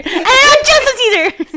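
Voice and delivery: high, slow laugh-crying. Real, not acted. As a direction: wail it through laughter.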